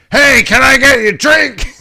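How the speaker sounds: high and loud Texan hostess call; mock yell. Texan flight attendant shouting an offer, high and loud. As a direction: belt it out like a brash hostess. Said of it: "She's yelling at you".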